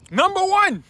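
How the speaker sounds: loud, very high countdown call; announcer shout. Real, not acted. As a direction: belt out the winner like an announcer. Announces top pick with fanfare.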